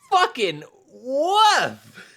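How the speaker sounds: high, slow, stretched-out groan of disgust; cartoonish cringe at a bad take; exaggerated disgust. Real, not acted. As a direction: drag the woof out like you can't believe it. Reacting to the tweet.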